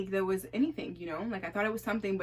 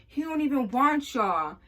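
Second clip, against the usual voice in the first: loud, higher mock taunt; 7 semitones higher, 7 dB louder than usual. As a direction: jeer it at the girls with a bit of swagger. Friend butts in, mocking girls.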